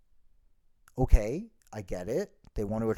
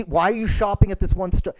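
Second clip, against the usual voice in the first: ordinary talk, nothing marked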